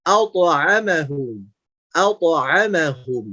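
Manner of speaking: slow "at'amahum" opened as "auto"; thick bouncing to. Shown as a demonstration. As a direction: draw out "at'amahum" with a heavy, echoed t. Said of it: "tapi auto". ("at'amahum" pelan, awalnya tebal "auto")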